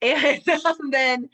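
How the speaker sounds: real laugh breaking into the words. Real, not acted. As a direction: giggle at your own fangirling. Laughs at herself.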